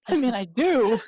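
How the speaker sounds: loud admission breaking into laughter; laughing admission. Real, not acted. Player admitting an attachment to ale, laughing. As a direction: own up to it through a laugh.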